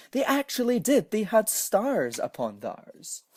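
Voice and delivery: delighted disbelief; amazed. A narrator's reading. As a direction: marvel that it worked. Amazed narration.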